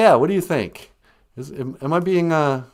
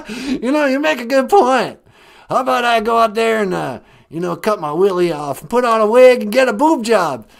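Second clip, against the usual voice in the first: hammed-up folksy politician, tickled by his joke; George W. Bush; 8 semitones higher, 7 dB louder than usual. Caller proposes his outrageous makeover, loud giddy impression. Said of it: "is this George W. Bush?".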